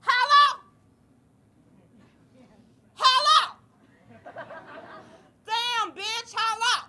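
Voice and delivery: very high, loud calling out; calling hello. Retold or imitated. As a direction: holler hello across the bus.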